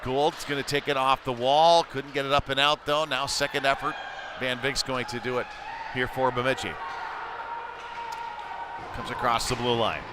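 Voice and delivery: ordinary talk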